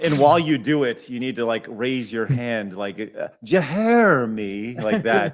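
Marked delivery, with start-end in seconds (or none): 3.4-4.8 Jeremy slow, every letter sounded out, rising at the end; over-pronounced. Shown as a demonstration. Host shows the letter-by-letter Jeremy, slow and loud. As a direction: spell out Jeremy in sound, slowly. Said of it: "pronounce every single letter phonetically".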